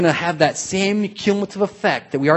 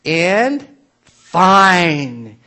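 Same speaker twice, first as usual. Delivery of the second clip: usual speaking voice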